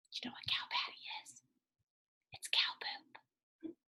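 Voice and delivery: whisper. Real, not acted. Reader whispering a cheeky secret to the children. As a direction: let them in on a naughty secret.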